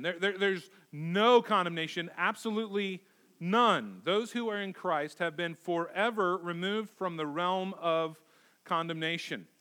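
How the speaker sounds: ordinary talk, nothing marked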